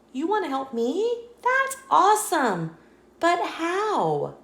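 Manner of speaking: high and delighted; light up at the offer of help; a small squirrel, thrilled; excited squirrel. Acted.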